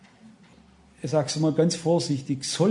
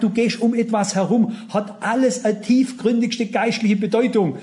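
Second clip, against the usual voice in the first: swelling pulpit insistence on every word; fervent preaching; 4 semitones higher than usual. Acted. Preaching at full force.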